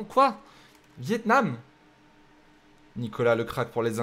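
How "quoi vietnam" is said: surprised quoi. Real, not acted. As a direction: ask it in real shock. Surprised that Vietnam is picked. (surpris, beaucoup plus aigu)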